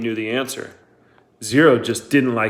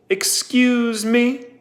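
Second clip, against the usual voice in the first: drawn-out, much higher disbelieving "Excuse me"; incredulous excuse me; 12 semitones higher, much slower than usual. A narrator's reading. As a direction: stretch it slowly, rising in disbelief. Warden's sharp incredulous challenge.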